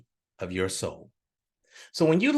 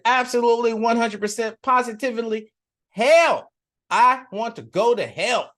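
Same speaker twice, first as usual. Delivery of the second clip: loud, higher, building to a shout on hell; eager choice; 6 semitones higher, 5 dB louder than usual. Acted. Politician tells the great being he picks hell, excited loud shout. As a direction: announce it with total excitement.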